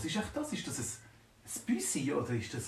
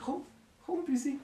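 coaxing; 11 semitones higher than usual. Acted. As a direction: lure the kitten close. Coaxing the kitten.

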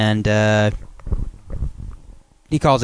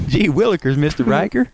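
put-on high eager gee-whiz kid; squeaky innocent kid voice; wholesome 1950s kid; 8 semitones higher than usual. Kid voice continued.

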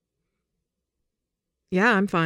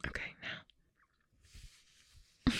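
very slow hushed whisper; drawn-out whisper; 14 dB quieter, much slower than usual. Real, not acted. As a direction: whisper it, stretched out. Whispered cue during kiss.

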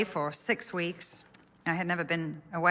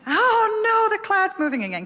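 much higher, louder wail of dismay; dismayed parent; 7 dB louder than usual. Retold or imitated. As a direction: cry out as the cloud leaves again.

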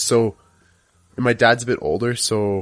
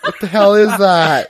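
shocked laughing question; 8 semitones higher, 7 dB louder, slower than usual. Real, not acted. Reacts to clown picture.

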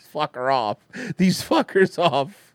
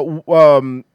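ordinary delivery

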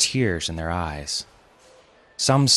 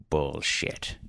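low, softer mumble under the breath; mumbled; 4 semitones lower, 7 dB quieter than usual. A narrator's reading. Grandfather cursing under his breath at the table, mumbled. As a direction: mumble the curse, turned away. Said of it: "Arthur looked away and mumbled".

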